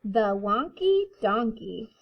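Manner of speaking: put-on reading to children. A narrator's reading. Reading picture book to kindergarten.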